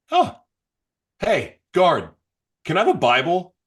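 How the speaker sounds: calling to guard. Retold or imitated.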